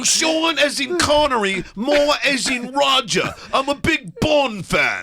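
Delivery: slow, high-pitched fake British accent; terrible English accent. Prank caller spelling out his fake name, in an awful English accent. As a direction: lay on a bad English accent, stretching the words. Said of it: "Worst English accent".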